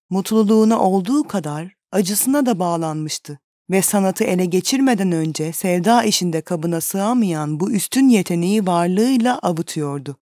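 usual speaking voice

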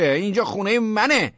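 high, loud indignant shout. A narrator's reading. Tenant emboldened by a mild intruder, shouting. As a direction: shout the claim with newfound nerve. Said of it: "داد زدم".

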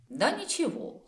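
high-pitched dismissive reply; wry, lifted pitch; amused scoff. A narrator's reading. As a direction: brush it off with a light scoff. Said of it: "хмыкнула Тиль".

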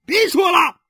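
very high, loud yell; furious shout. Acted. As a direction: cut her off with a yell. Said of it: "许三观喊完".

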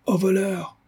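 high, distant call of alarm; sudden high shout of alarm; coachman crying thief. A narrator's reading. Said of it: "nous entendîmes le cocher crier".

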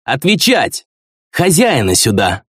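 put-on high, drunken barked order; drunk commanding shout. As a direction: slur a bossy demand. Drunk prince demands the host.

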